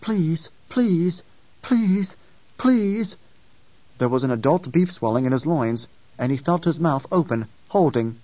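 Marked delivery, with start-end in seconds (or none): 0.0-3.2 rising desperate plea, higher and higher; each please climbing in pitch and volume; pleading. A narrator's reading. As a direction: beg harder with each please. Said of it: "A voice pleaded in his skull".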